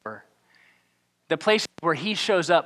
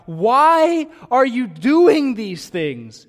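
loud, high, slow plea; crying out; 7 semitones higher, 5 dB louder than usual. A narrator's reading. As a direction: call out over the crowd. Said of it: "crying out".

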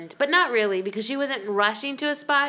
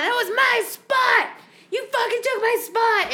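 retold angry shouting; 13 semitones higher, 5 dB louder than usual. Mom shouting at the couple who took her spot. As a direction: bellow it like an enraged mom. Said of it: "she just went off".

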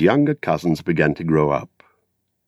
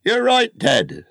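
put-on high, slow old gardener voice; high creaky rustic old man; old Gaffer; slower than usual. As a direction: hold forth like a proud old gardener at the inn. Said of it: "said the Gaffer".

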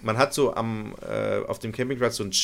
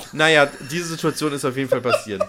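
ordinary talk

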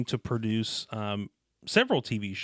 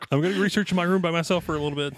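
put-on fast, higher sly teen voice; mock teenager; 6 semitones higher, 4 dB louder, much faster than usual. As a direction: rattle it off like a sneaky kid. Acting out a teen.

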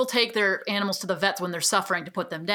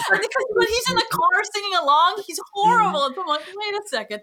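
amused; 10 semitones higher, 3 dB louder than usual. Real, not acted. Mocking his bad singing.

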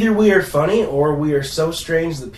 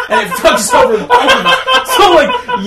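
laughing through the words, slowly; the words break into real laughter; 9 dB louder, slower than usual. Real, not acted. Laughing at own scenario.

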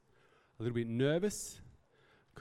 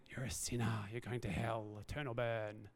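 weird preachy voice; 2 semitones lower, 6 dB quieter than usual. Retold or imitated. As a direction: lightly play a judging preacher. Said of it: "always in a weird voice".

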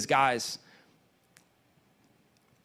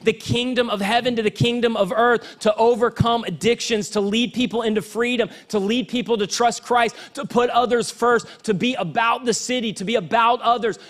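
preaching at full force; 7 semitones higher than usual. Acted. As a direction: preach it out to the whole church, building.

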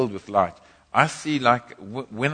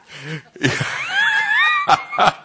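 very high shrieking laugh; wild laugh; 7 dB louder than usual. Real, not acted. Laughs about burning people.

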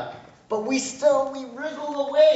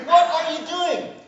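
exasperated God; 7 semitones higher, 6 dB louder than usual. Retold or imitated. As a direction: scold foolish little kids from above. God scolding again.

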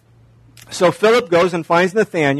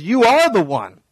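excited; 5 semitones higher than usual. Retold or imitated. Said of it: "He gets excited".

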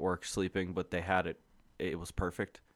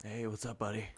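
much softer, understated greeting; quiet sleeper's reply; 10 dB quieter than usual. Retold or imitated. Sleeping coworker answering a visitor, quiet imitation. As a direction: answer softly, as if barely roused.

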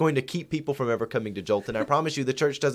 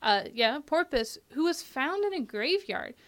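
much higher, put-on host voice; high interviewer voice. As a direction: play a bright radio host. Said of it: "We're gonna do a phone interview with them".

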